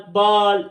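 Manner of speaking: "बाल" with a long open aa; long aa sound. Shown as a demonstration. Teacher revising rule one, long aa in "बाल". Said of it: "बड़े आ की sound निकाल रहा है".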